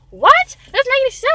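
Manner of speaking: very loud, high-pitched incredulous yell; exasperated outburst. Acted. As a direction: explode in disbelief mid-argument. Acting out exasperated outburst in argument.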